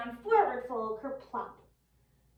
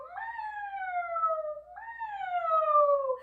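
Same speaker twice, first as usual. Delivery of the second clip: cat meow. Shown as a demonstration. Teacher leading kids in cat pose meow. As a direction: mew like a little cat. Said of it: "raise our backs and go".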